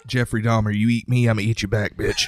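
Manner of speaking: shouting with a laugh; loud and laughing. Real, not acted. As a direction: belt it out, cracking up. Joking answer, shouted punchline.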